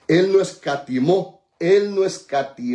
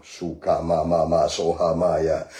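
speaking in tongues; 12 semitones lower than usual. Real, not acted.

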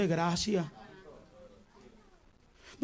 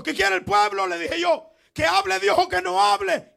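high, loud challenge; calling to the crowd; 10 semitones higher, 4 dB louder than usual. Retold or imitated. Preacher challenging the crowd, loud high call. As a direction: shout the question at the congregation.

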